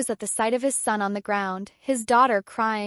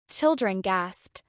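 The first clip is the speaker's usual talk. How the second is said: usual speaking voice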